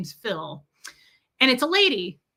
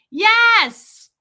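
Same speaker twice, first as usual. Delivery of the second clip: high, very loud, drawn-out yes; mock yelled retort; 11 semitones higher, 7 dB louder than usual. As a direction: yell it back defiantly. Retorts to screaming man in story.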